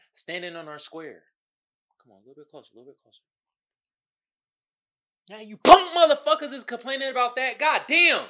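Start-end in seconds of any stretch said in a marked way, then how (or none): 2.0-3.2 very soft, almost under the breath; confidential, barely voiced lure; hushed beckoning. Beckons the viewer closer before outburst. 5.3-8.3 sudden high, loud yell; loud, high-pitched indignation; exasperated outburst. Real, not acted. Sudden outburst after luring viewer close.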